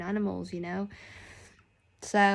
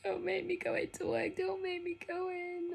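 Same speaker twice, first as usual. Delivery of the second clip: put-on much higher, small child begging; child alter pleading; 10 semitones higher, 7 dB quieter than usual. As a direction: plead like a little kid not wanting to go. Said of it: "my little woke up and was just like".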